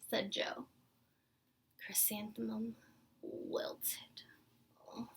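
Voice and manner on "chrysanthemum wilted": plain reading voice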